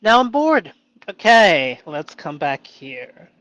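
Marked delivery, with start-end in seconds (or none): 0.0-1.8 loud, high exaggerated bored groan; mock boredom. Suddenly declaring he's bored, loud and hammed-up. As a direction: whine it out theatrically. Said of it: "sudden mock boredom".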